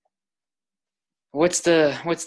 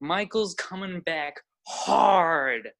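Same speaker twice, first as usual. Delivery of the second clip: high-pitched, drawn-out thrilled cheering; real excitement for a friend's performance; hyped; 9 semitones higher, slower than usual. Real, not acted. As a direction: crow it slowly, stretching the words. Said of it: "I'm so hyped right now".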